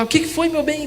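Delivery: high-pitched imitation of a calling wife; mock high holler. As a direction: call the question through from another room. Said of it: "gritou lá".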